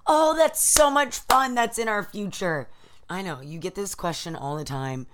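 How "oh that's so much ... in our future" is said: excited gushing. Real, not acted. Gushing about rollerblading.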